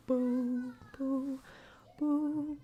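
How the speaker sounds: usual speaking voice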